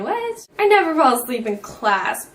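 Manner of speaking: usual speaking voice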